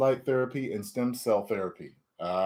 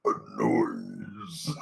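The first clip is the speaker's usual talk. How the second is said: Super Mutant voice; 6 semitones lower, 7 dB quieter than usual. Acted. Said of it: "Super Mutant impression?".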